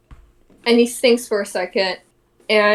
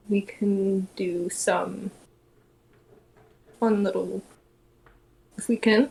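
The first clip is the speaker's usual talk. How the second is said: telepathic murmur; 5 dB quieter than usual. Acted. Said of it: "He's like".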